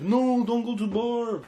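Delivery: goofy. Retold or imitated. Retelling how he begged the girls not to go, goofy high whine. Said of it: "He got so goofy".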